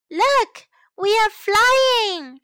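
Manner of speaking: high, loud joyful shout; happy shout. Acted. Mother and child running downhill, loud happy shout. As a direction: shout it together, slow and gleeful. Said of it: "shout Mommy and May happily".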